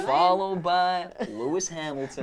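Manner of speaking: very slow Texan drawl; Texas accent. Acted. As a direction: stretch each word like a cowboy. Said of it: "Do I do the accent?".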